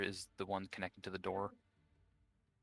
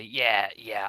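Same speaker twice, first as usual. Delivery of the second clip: hasty cover-up; 10 dB louder than usual. Acted. Player covers for Amber to guard.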